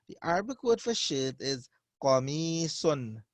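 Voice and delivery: ordinary talk, nothing marked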